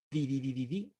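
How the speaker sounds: "The" repeated as "thee" /ði/; pronouncing the. Shown as a demonstration. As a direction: drill "The" with a long ee sound. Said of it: "Everybody says the".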